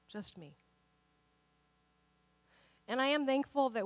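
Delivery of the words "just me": fading repeat. Retold or imitated. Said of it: "Jesus is like".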